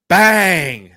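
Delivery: sudden loud shout of excitement. Retold or imitated. Fan shouting at a great shot, loud. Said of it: "That's what I was saying under my breath".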